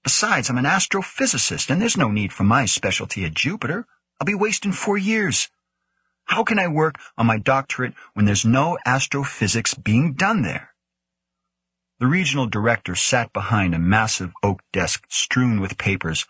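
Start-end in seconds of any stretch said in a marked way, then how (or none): 0.0-10.7 higher-pitched, aggrieved protest; urgent pleading tirade. A narrator's reading. Young scientist protesting a posting to the director, urgent pleading. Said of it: "all through his urgent, pleading tirade".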